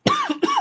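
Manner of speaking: sudden choking cough; coughing fit. Real, not acted. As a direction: cough hard, something went down wrong. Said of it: "down the wrong hole".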